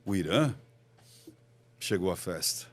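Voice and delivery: low and soft, long pause after "Irã"; changed intonation. Shown as a demonstration. Speaker shows the same news reworded by tone, low and halting. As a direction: hold a gap before "chegou à festa", voice dropped. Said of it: "mudei a entonação".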